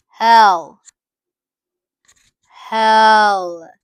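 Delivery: loud, final "Hell" with a falling tune; loud verdict. Real, not acted. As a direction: declare it loudly, no appeal. Sending him to hell.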